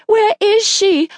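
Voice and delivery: high, slow, stretched cry; frantic. A narrator's reading. Mother asking stranger for her child, frantic.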